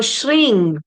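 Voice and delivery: SHRING ending in a nasal NG; correct nasal ending. Shown as a demonstration. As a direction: let SHRING ring in the nose. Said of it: "is the way to pronounce it". (SHRING with NG, lips open)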